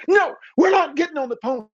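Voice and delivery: put-on high, panicked child protest; two boys refusing in terror; frightened refusal. Little brothers pressed against the car door, refusing in a high panic. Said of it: "and said".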